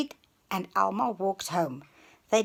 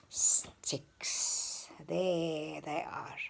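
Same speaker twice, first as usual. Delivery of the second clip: whispered answer; 4 semitones lower, 8 dB quieter, much slower than usual. Real, not acted. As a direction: breathe the word secretly. Secret answer to child.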